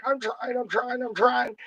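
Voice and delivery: high, strained little dog voice; struggling pit bull. Retold or imitated. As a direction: squeak it out like a dog being dragged along. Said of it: "that poor pit bull's just like".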